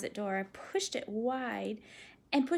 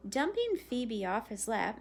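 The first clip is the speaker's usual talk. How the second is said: plain reading voice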